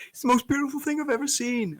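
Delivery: high, sustained wonder-struck voice; awestruck cartoon dad. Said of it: "he's like".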